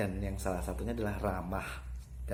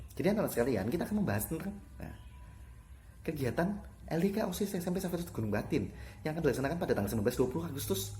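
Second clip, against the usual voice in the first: rushed, higher-pitched gabble; too fast; 7 semitones higher, much faster than usual. Shown as a demonstration. Teacher shows pupils bad pacing, far too fast. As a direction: race through it without a breath. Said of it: "kalau terlalu cepat jadi".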